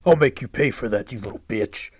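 furious growl after being bitten; angry threat. Acted. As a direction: spit the threat out in pain. Wounded man threatens Liz.

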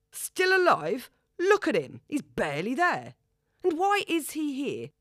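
shouted high, "still" flung up in a rising yell; outraged disbelief. A narrator's reading. Father shouts at his ex-wife's comfort, incredulous. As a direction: throw her words back at her in fury.

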